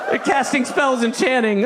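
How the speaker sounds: high, loud mock alarm; panicked shouting. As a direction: sound the alarm in hammed-up terror. Panicked onlookers' warning continues.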